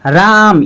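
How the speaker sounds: राम with the आ stretched to three beats, slowly; drawn-out Ram. Shown as a demonstration. Teacher shows pupils the three-matra vowel, slowly drawn out. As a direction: hold the vowel in राम long, like calling out. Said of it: "इसको अ कहते हैं".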